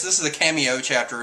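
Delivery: normal talking voice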